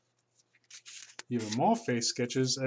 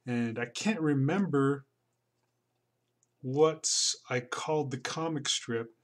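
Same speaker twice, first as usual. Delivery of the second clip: ordinary talk, nothing marked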